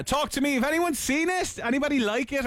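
high, bright call to the audience; calling out to viewers. Real, not acted. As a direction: invite the listeners to answer.

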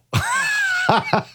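1 laughing. Real, not acted. Laughs at his own accent.

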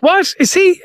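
high, incredulous double take; mock disbelief. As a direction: act stunned at the news. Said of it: "And I just was like".

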